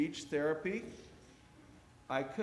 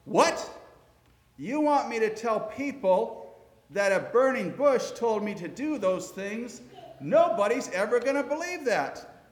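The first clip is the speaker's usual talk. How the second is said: loud, high incredulous protest; outraged disbelief, raised voice; objecting; 7 semitones higher, 7 dB louder than usual. Acted. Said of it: "and Moses objects".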